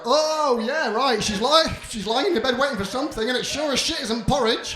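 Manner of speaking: loud scornful shout; mock him at full volume, fuming; sarcastic yelling Mama Bear.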